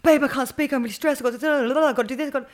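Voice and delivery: high, loud, frazzled gabble; stressed-out caller. Retold or imitated. Author mimicking herself when people phone her, stressed-out gabble. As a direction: act out being swamped and frantic. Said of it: "guilty for people calling me up and go".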